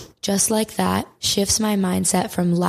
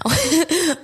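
laugh; 9 semitones higher than usual. Real, not acted. Laughs at own joke.

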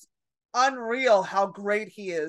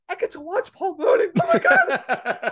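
high, loud fangirl squeal; freaking out; 10 semitones higher, 6 dB louder than usual. Retold or imitated. New waitress about to watch her idol perform, freaking out. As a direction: squeal with starstruck glee, breaking into laughter. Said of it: "freaking out".

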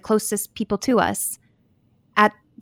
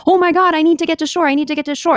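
higher, louder, rushed mock panic; 8 semitones higher, 4 dB louder, faster than usual. Therapist imitating swimmers desperate to get to shore, mock panicked. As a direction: play a frantic swimmer, hurried and raised.

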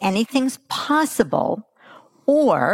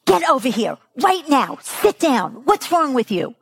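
scolding parent; 6 semitones higher than usual. Retold or imitated. Impatient parent scolds a restless five-year-old in a restaurant, angry. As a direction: bark at the kid across the table. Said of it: "this is what you hear".

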